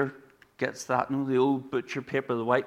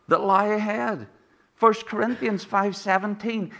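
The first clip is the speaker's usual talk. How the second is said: a raised, urgent pulpit call; rousing preaching; 8 semitones higher than usual. Acted. Preacher rallies the congregation, rousing and raised. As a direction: lift the congregation, pitch up and press. Preaching rises to full force.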